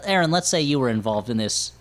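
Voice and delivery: ordinary delivery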